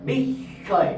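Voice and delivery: বিস্ময় split as bish-shoy; doubled sibilant. Shown as a demonstration. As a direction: hold the sh twice in বিস্ময়, no m. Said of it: "দন্তাক্ষর উচ্চারণটা দুবার হচ্ছে".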